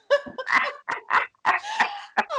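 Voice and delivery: high, bursting real laughter. Real, not acted. Mother retelling her son's joke, laughing high. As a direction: let the laugh break out high.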